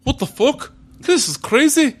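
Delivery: high-pitched, hammed-up Scottish accent; Scottish accent comic outburst, breaking into laughter; mock Scottish Cable. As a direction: put on a silly high Scottish voice. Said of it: "Cable with a Scottish accent's the greatest thing".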